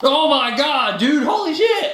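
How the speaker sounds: high, excited gasp of awe; amazed. Acted. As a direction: blown away by the picture. Amazed by the graphics.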